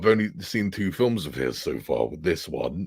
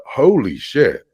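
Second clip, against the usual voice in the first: loud, raised exclamation of amazement; higher, louder awestruck reaction; retold astonishment; 5 semitones higher, 9 dB louder, slower than usual. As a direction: punch it out, stunned by what you found. Retelling own astonished reaction.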